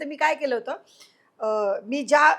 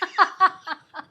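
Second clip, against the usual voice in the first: bright burst of laughter, pitched up; 7 semitones higher, 4 dB louder than usual. Real, not acted. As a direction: laugh out at the funny memory. Laughing at the memory. (हसू)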